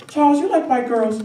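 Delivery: high, loud nosy church lady; church first lady. Acted. First lady asks the boy about girls, high and pointed. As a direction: pitch it up as the first lady questioning him. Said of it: "the first lady looks at me and she goes".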